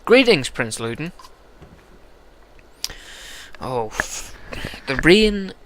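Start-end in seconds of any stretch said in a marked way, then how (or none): 3.6-5.5 put-on a first stab at an English accent, pitched up; half-hearted English accent on "the rain". Gamer mocking an English accent, half-hearted. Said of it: "do a fucking English accent".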